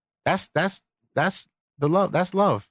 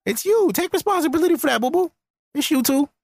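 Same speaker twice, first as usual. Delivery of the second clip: put-on much higher teasing baby talk; mocking sing-song; 9 semitones higher than usual. Shown as a demonstration. Mocking the viewer as a child, much higher. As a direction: scold like talking to a toddler.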